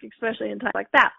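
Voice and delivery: ordinary talk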